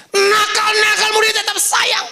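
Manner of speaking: high, loud and ringing; shouted preaching. Acted. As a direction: proclaim it to the whole room. Same line repeated, shouted.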